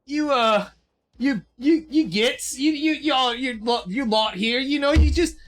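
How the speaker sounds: high, stammering Aussie rogue; wheedling governor with an Aussie crook's voice; Kano. Acted. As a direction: pester them in a put-on Australian accent. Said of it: "Wallace Rankin is now Kano".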